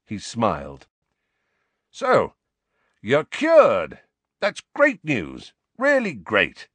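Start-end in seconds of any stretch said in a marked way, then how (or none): 1.9-6.7 higher, effortful brightness; put-on delight, pitched up; forced cheer. A narrator's reading. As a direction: force out congratulations with a strained smile. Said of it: "like an enormous effort, he smiled".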